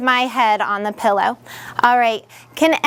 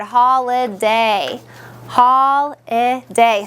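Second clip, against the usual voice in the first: "holiday" slowly split into three syllables, twice; much slower than usual. Shown as a demonstration. Teacher repeating the secret word for pupils, slow and syllabified. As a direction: draw out each syllable of "holiday". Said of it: "we're spelling our whole secret word".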